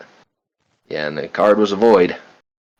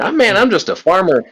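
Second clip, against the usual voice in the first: high character voice; 10 semitones higher, 4 dB louder than usual. Acted. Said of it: "It's like".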